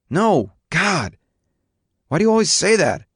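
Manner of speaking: high-pitched indignant protest; exasperated teenage son. A narrator's reading. Son answering his father's questioning, indignant and high.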